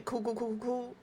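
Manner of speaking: soft, rapid repetition hiding rage; masking anger. Shown as a demonstration. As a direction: toss it off quietly, fury held in. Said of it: "internally I am raging".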